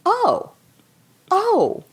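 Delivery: high, spaced-out mock "oh"s; dawning realization. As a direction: act out news slowly sinking in. Retold husband taking in the revelation.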